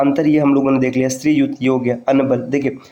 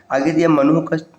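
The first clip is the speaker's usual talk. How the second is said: ordinary talk, nothing marked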